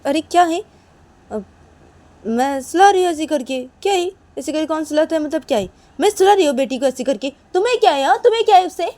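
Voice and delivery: loud, high, defensive comeback; indignant quarrelling voice; irritated retort. Acted. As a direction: argue back with mounting exasperation. Acted quarrel over the baby.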